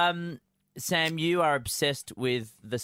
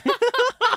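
loud laugh; 9 dB louder than usual. Real, not acted.

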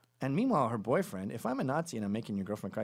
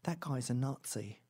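slow, held-back accusation; slow, restrained finger-pointing; muttered accusation; 4 dB quieter than usual. Retold or imitated. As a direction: accuse someone slowly, without raising the voice. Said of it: "they go".

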